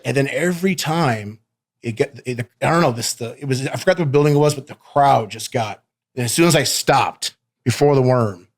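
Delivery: ordinary delivery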